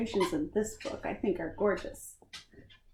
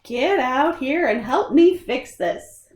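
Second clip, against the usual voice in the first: louder, a scolding mom calling; 4 semitones higher, 10 dB louder, slower than usual. Acted. Mom calls the kids to fix the mess, louder and scolding. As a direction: call them in like a fed-up mom. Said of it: "says Mom".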